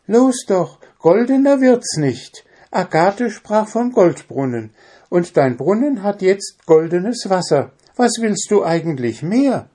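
very high, loud-starting bird voice; impatient Zwitschi. Acted. Zwitschi cannot understand the dwarf's hesitation, high impatient voice. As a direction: push him hard in a squeaky pitch.

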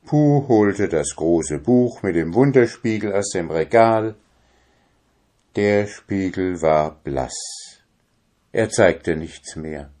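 plain reading voice

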